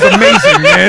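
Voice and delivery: high, laughing praise. Real, not acted. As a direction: gush while laughing.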